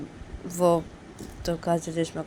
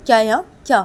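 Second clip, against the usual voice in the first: irritated challenge; 5 semitones higher, 12 dB louder than usual. Acted. Irritated character snaps back.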